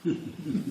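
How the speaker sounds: quick airy chuckle; short laugh. Real, not acted. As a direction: laugh at your own joke. Laughs at own joke.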